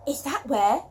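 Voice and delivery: usual speaking voice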